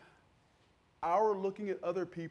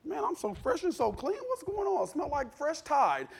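very high-pitched mock marvelling; imitated giddy amazement; 10 semitones higher than usual. Men walking out of the fire, high giddy amazement. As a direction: play someone thrilled to come out untouched.

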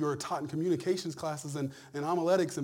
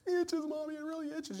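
put-on high pleading kid voice; child begging his mom, whiny and high; 12 semitones higher, faster than usual. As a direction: whine like a little kid to his mommy. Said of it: "I can hear my mom saying".

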